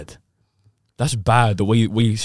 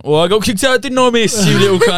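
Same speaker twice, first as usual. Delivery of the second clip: cheeky student; 12 semitones higher, 7 dB louder than usual. Retold or imitated. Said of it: "the teacher be like".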